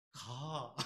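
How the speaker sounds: low, soft, drawn-out Chungcheong word; acted Chungcheong driver giving in; terse Chungcheong "go". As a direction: stretch the one word out, Chungcheong style. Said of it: "성질 급한 놈이 먼저".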